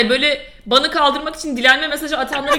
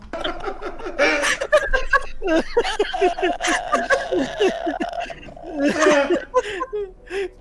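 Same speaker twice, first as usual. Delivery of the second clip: long laugh; 5 semitones higher, 4 dB quieter than usual. Real, not acted.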